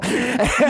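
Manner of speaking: sudden high cackle; high burst of laughter. Real, not acted. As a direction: laugh out at the joke. Laughs at friend's quip.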